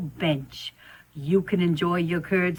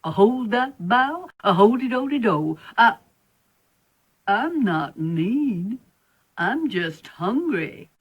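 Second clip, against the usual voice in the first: sing-song spider; 4 semitones higher than usual. Acted. Spider sings and protests.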